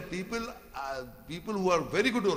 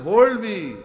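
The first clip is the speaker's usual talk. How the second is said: loud plea; 4 semitones higher, 12 dB louder than usual. Acted. Preacher voicing Pharaoh's real meaning, loud shout. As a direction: Hold me shouted much louder. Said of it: "in the truth he says".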